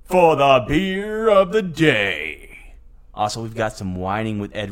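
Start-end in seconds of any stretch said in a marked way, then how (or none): 0.0-2.7 slow drawn-out segment call; announcer voice. Acted. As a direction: announce it like a game show.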